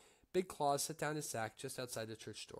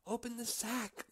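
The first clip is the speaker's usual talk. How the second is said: shouting; 11 semitones higher than usual. A narrator's reading. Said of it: "Little Claus shouted".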